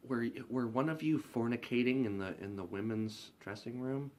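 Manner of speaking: a stiff boss asking about the dressing room; prim manager. Retold or imitated. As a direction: ask it primly, scandalized.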